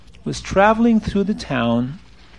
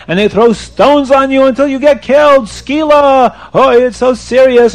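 raised, high, loud scolding; screaming rebuke; 6 semitones higher, 6 dB louder than usual. Acted. Rabbi warns a Sabbath breaker of punishment, screaming rebuke. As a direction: scold with mounting alarm. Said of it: "he starts screaming at the Yid".

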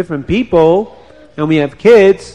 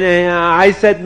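ordinary delivery, nothing marked

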